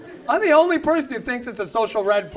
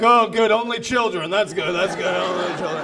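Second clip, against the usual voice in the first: low, trailing-off relief; relieved diners; 5 semitones lower than usual. Acted. Diners going back to their meal, mock relieved. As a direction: settle back down, murmuring contentedly.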